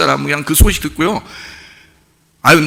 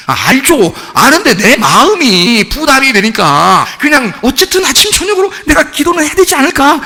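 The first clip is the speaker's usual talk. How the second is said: raised, slower, pleading answer to God; a sheepish servant answering God, higher pitched; pleading reply; 9 semitones higher than usual. Retold or imitated. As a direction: rise higher and higher while explaining yourself. His own reply to God.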